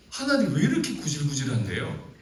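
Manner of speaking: high, pleading complaint to God; exasperated plea. Acted. Preacher arguing with God, high and exasperated. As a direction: argue with God, fed up.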